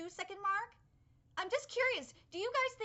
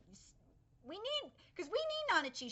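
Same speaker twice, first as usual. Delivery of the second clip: normal talking voice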